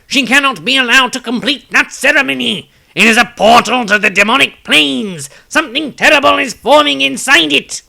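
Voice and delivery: loud, high urgent cry of alarm; urgent warning. Acted. Tobias warning his companions, loud and urgent. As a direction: shout the danger to the group. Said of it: "Tobias spoke aloud".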